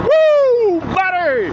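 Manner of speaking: very high, loud whooping; hyped whoop. Real, not acted. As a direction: let out a big whoop. Hyped intro before install.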